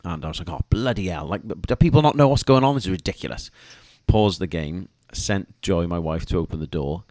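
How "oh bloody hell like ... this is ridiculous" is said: rising to an annoyed shout mid-rant; fed up at being interrupted, voice jumping up; exasperated outburst. Retold or imitated. Man interrupted mid-match, exasperated outburst.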